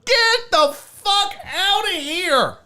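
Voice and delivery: high, drawn-out, exaggerated disbelief; scoffing, long-drawn high cry; incredulous outburst. Real, not acted. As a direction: stretch it out high in mock outrage. Scoffing at fancy flour claim.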